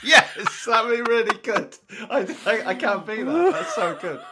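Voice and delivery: high, loud, laughing through the words; can't stop laughing at the joke; laughing hard. Real, not acted. Laughing at the Jonah Hill pick.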